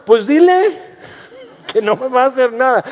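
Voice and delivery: high, fast, frightened comeback with a laugh; panicky retort. Retold or imitated. Preacher acting scared, answering someone who says the bug won't hurt. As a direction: blurt it out, half laughing, half scared.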